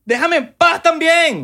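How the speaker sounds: high, loud, dragged-out plea; mock anguished wail. Host wailing at MLM recruiters to leave him alone, high and drawn out. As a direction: beg them to leave you alone, hammed up.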